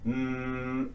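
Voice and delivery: "mm" as a long, soft hum; voiced M. Shown as a demonstration. Teacher letting students feel vibration, a held "mm". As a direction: hum "mm" on a level note. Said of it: "or the M sound".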